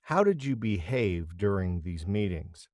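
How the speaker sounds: ordinary delivery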